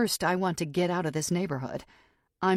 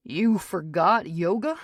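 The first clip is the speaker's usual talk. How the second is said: slow, louder disbelief; can't believe she forgot yoga; incredulous; 2 semitones higher, 6 dB louder, slower than usual. A narrator's reading. Jarrett on the phone, incredulous she skipped yoga. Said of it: "He's incredulous".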